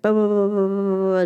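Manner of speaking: lightly imitated anxious talker who never pauses; mock nonstop talker. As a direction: babble on so nobody can cut in. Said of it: "doesn't have any pauses when they're speaking".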